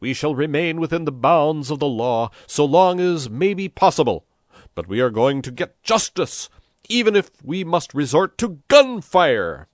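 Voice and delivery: loud, high rabble-rousing oratory; fiery, declamatory political speech; shouted speech. A narrator's reading. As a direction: proclaim it to a crowd from a balcony. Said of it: "shouted Pepone".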